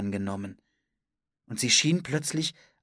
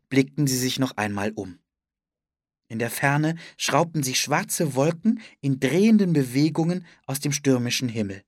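ordinary delivery

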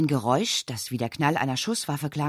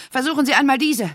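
breathless; 9 semitones higher, 6 dB louder than usual. Acted. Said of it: "sagte sie atemlos".

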